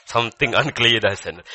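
loud proclamation of alarm; mock warning. Grandpa reacting as Siri runs in, loud mock warning. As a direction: call it out forcefully as a warning.

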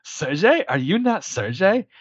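high, rising mock Russian pleading; Bogdan voice. Retelling the van scene, doing Bogdan's confused questions. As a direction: ham up a confused goon asking his friend. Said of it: "Bogdan character".

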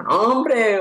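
high, laughing mock shout; laughing mock yell of protest; mock yell at the radio. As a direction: retell yelling at the podcast, half laughing. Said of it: "pues os voy gritando".

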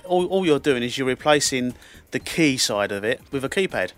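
ordinary delivery

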